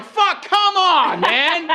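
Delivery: high, drawn-out mock outrage; acted exasperation. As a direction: groan it out in comic disbelief, pitch way up. Acts out exasperation at the note.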